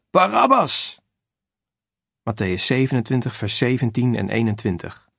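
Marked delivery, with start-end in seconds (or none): 0.1-1.0 crowd shouting. Acted. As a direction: yell the name like an angry mob. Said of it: "De menigte schreeuwt". (luide schreeuw van de menigte)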